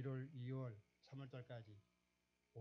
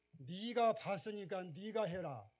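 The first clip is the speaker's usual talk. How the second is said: Jeolla dialect, slow, higher and louder, falling firmly on 해라; emphatic Jeolla dialect; 6 semitones higher, 8 dB louder, slower than usual. Retold or imitated. Preacher booming God's command in Jeolla dialect, slow. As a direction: pronounce each word in Jeolla dialect with weight, slow and loud. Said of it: "전라도 말로 한번 해볼게요".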